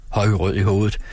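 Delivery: usual speaking voice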